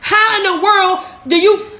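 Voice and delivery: usual speaking voice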